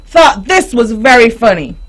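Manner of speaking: ordinary delivery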